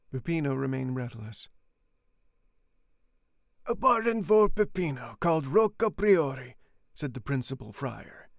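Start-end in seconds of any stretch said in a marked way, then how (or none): 3.7-6.6 high, loud announcement; loud proclamation. Acted. The friar naming Peppino loudly to the crowd. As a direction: proclaim the name to the whole square.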